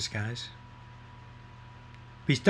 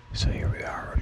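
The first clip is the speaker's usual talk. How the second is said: whisper; 5 dB quieter than usual. Acted. Starts whispered segment.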